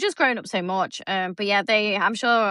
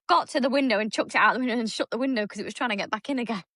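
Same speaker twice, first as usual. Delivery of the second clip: ordinary talk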